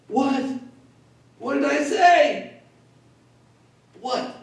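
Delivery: put-on high, alarmed kid voice, pitched well up; a boy sure he's in trouble; panicked disbelief. A nine-year-old panicking at his stern dad, high and alarmed.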